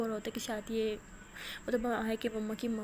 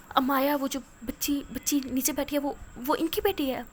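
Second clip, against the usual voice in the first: stunned; 3 semitones higher, 6 dB louder than usual. Acted. Old lady stunned.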